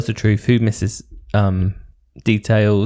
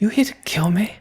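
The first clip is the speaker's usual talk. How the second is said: a whispered, higher posh English imitation of Caroline; mock posh whisper; 9 semitones higher, 3 dB quieter than usual. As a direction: breathe it like a prim English lady. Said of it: "Caroline speaks like this".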